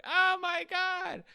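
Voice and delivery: loud, very high drawn-out mock cry; hammed-up squeal of despair; dismayed wail.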